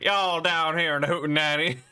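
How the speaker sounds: cowboy Texas twang for a foreign noble; hammed-up Texas accent. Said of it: "with a Texas accent".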